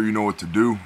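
ordinary talk

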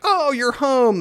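acted delighted greeting. Staged homecoming cutscene greeting.

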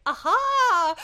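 loud, high drawn-out aha; mock realization. Monkey pretending to follow the villain, mock realization. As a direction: imitate a monkey having a big revelation.

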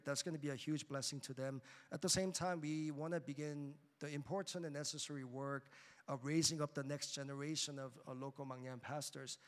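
normal talking voice

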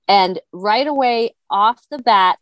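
normal talking voice

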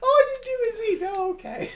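hammed-up losing argument with a pet; mom scolding cat. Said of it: "conversation going on between her and the cat".

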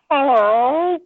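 high meowed hello, rising at the end; cat's hello. Retold or imitated. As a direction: meow it like an English cat saying hello. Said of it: "he kind of looks back and he goes".

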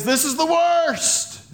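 high, slow mock whine; mock despair. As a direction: act out someone moaning. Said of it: "What if everyone says".

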